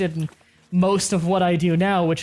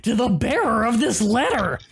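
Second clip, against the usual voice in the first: Gremlin voice; 3 semitones higher than usual. Acted. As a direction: read it like a grinning gremlin. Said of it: "You can read horse armor note in Gremlin voice".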